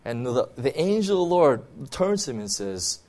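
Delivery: usual speaking voice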